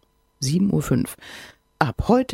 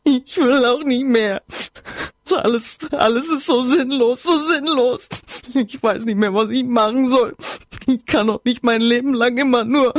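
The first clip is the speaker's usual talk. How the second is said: higher, louder tearful wail; sobbing despair; 6 semitones higher, 3 dB louder than usual. Acted. Distraught friend pours her heart out on the phone, sobbing wail. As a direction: keep crying through every word. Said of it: "schluchzte sie".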